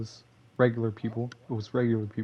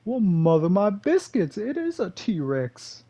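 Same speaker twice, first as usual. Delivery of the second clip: amused surprise; 7 semitones higher, slower than usual. Real, not acted. Discovering T-Rex picture.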